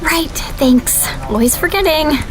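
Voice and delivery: usual speaking voice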